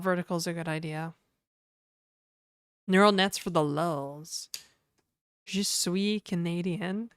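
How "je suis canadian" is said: slow bad French accent, je suis stretched; mock French accent. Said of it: "my terrible French accent".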